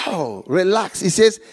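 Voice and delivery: normal talking voice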